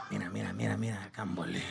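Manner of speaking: a hint of a disgusted father grumbling; grumbling dad. Dad muttering while watching the little brother mess up. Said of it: "this is him watching my little brother".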